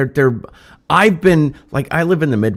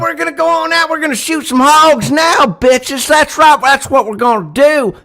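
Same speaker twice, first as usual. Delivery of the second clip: Southern US accent; 6 dB louder than usual. Shown as a demonstration. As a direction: whoop it up in a hammed-up Southern accent. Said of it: "That southern kind of thing".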